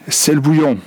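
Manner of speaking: sudden high shout; shouted warning. A narrator's reading. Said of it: "il y en a un qui a crié".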